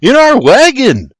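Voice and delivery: high, loud urgent holler; shout. A narrator's reading. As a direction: shout it from the doorway. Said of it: "William shouts".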